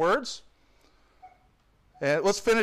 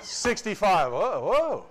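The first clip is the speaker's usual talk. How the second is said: higher, swooping astonishment; mock amazement; 3 semitones higher, much slower than usual. Pastor reacting to fast church growth, amazed. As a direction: play up being bowled over.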